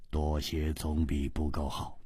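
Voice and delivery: very low, soft understatement; cool, indifferent. Acted. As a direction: say it quietly, deep in the voice. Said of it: "淡淡的说".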